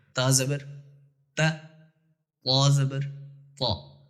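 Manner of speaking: تا said light and thin, then طا said full and heavy. Shown as a demonstration. Teacher showing students the thin تا and heavy طا. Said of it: "تا باریک پڑھی جا رہی ہے اور طا موٹا".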